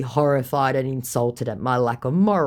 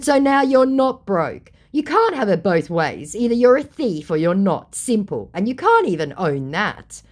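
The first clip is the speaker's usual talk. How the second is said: higher, louder exasperated retort; mock-exasperated comeback; retold angry reply; 6 semitones higher, 4 dB louder than usual. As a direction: corner him with the point. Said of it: "I said".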